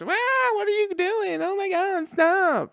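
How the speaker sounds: very high squawky hen woman's voice; hammed-up hen shrieking at the fight; shrill hen voice. Hen yelling at fighting rooster, very high squawky voice. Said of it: "Just starts".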